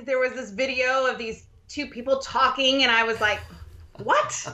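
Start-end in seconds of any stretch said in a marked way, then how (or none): 4.0-4.5 shocked what. Retold or imitated. Retold shock at husband's purchase.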